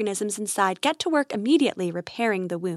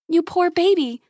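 higher, tender cooing sympathy; tender pity; 7 semitones higher, 3 dB louder than usual. Acted. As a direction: coo over a hurt baby animal. Grace pities the injured animal.

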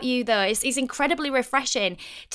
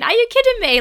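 much higher, louder disbelief; host's retold astonished thought; incredulous; 10 semitones higher, 6 dB louder, faster than usual. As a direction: burst out in amazement. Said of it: "I'm thinking".

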